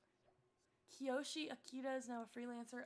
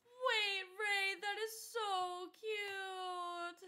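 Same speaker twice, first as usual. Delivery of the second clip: raised, drawn-out gushing over cuteness; 5 semitones higher, 5 dB louder, much slower than usual. Real, not acted. Streamer hearing about a viewer's new cat, gushing. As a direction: melt slowly over something adorable.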